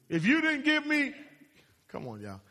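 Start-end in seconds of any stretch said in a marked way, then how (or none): 0.1-1.1 higher, lightly acted blaming whine; mock accusing spouse. A hint of the woman blaming Adam. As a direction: point the finger, pitched up. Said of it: "And the woman".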